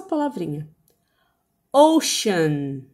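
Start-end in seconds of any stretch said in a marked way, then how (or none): 1.7-2.8 OCEAN said slowly, lower in pitch; careful, drawn-out OCEAN; slow teaching demo. Shown as a demonstration. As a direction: model the word OCEAN slowly for repeating. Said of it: "Eu vou falar devagar agora".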